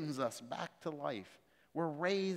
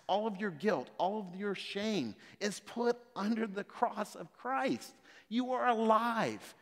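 higher, louder, impassioned sermon delivery; preaching at full force; 8 semitones higher, 4 dB louder than usual. Acted. Preacher urging the congregation about their past, impassioned preaching. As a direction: rise up and preach it to the back row.

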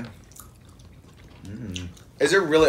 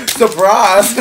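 excited; 7 semitones higher, 10 dB louder than usual. Pretend. Mock reveal of worm on package.

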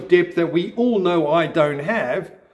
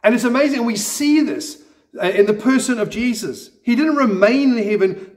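usual speaking voice

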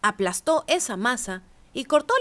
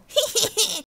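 high teasing little laugh; cheeky high giggle; 11 semitones higher than usual. A narrator's reading. Gingerbread cowboy laughing at the rancher, high giggle. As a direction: giggle up high like a naughty cookie. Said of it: "se rió y dijo".

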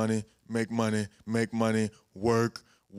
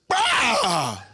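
sudden high, loud blurt; outburst; 14 semitones higher, 7 dB louder than usual. Retold or imitated. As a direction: let it burst out in anger. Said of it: "felt like going like, "Bah!".

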